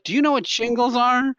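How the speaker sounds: put-on high-pitched oversharing old lady; old lady customer. Old lady quizzing grocery clerk, high voice. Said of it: "this lady's like".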